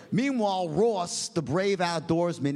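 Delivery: normal talking voice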